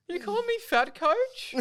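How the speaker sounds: very high, loud, hurt voice; high offended squeak. Acted. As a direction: squeal back at the coach. Player answers coach at halftime.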